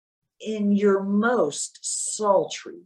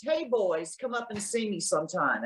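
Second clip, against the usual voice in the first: Mae West; 4 semitones lower, 8 dB quieter than usual. Shown as a demonstration. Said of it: "channel Mae West".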